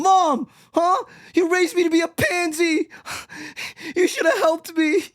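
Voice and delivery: hammed-up complaint to mother; mock whiny son. Accusing mom in mock scene.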